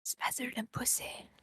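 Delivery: soft whispered aside. Acted. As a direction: keep it a hushed whisper. Continuing ASMR whisper bit.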